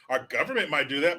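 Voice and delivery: ordinary talk, nothing marked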